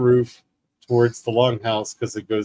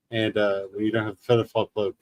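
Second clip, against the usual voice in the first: ordinary delivery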